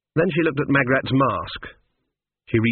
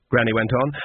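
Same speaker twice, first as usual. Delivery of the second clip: plain reading voice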